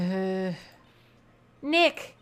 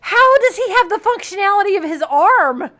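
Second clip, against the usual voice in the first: much higher, loud disbelieving yell; incredulous shout; 9 semitones higher, 11 dB louder than usual. Real, not acted. Player ranting about the shot prosecutor, incredulous shout. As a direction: shout it like it makes no sense.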